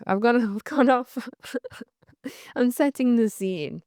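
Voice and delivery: laughing at herself while she talks. Real, not acted. Telling viewers she went off track, laughing. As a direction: giggle through it, amused.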